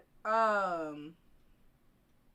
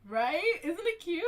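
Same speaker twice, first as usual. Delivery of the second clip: gushing excitement; 9 semitones higher, 6 dB louder than usual. Real, not acted. Gushing over the drawing.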